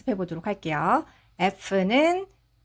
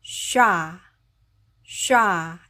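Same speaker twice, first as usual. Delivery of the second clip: "쉬아" quicker, nearly sha; "쉬아" daha hızlı, şa'ya yakın; faster shi-a; 2 semitones lower, much slower than usual. Shown as a demonstration. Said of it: "좀 더 빨리 해볼까요".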